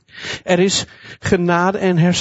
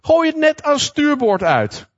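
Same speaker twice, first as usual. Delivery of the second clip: higher mock call from the shore; a gentle raised call to the fishermen; calling across water; 10 semitones higher than usual. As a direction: call it out to the boat, lightly. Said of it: "riep Jezus".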